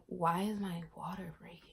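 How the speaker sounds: hushed, stunned question; whispered disbelief. Retold or imitated. Woman stunned her water broke, whispering to herself. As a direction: breathe it out in shock.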